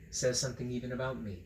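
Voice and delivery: usual speaking voice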